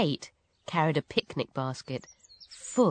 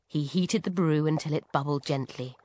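plain reading voice

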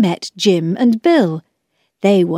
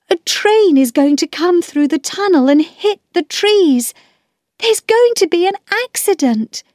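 put-on high, urgent child's voice; alarmed storybook girl; worried Roberta; 10 semitones higher than usual. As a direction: warn of disaster in a raised, girlish pitch.

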